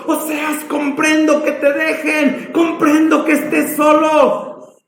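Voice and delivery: high, impassioned repeated address; God's fervent empathy. Acted. Preacher voices God consoling Hosea fervently.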